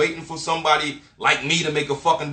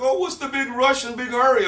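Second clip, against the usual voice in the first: mock clueless local; 8 semitones higher than usual. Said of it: "And it's like".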